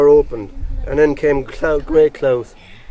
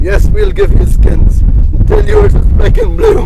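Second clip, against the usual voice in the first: slow, louder taunt rising very high at the end; mocking threat; 9 semitones higher, 5 dB louder, slower than usual. Crowd threatening Grey Clothes, slow loud mocking taunt. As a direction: jeer at him, then squeal the threat. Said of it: "mocking him".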